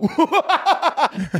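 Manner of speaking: very high, forced mock cackle; overcompensating laugh. Comic overcompensates beside his idol, high forced laughing fit. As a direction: burst into far too much laughter. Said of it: "just, like, overcompensate".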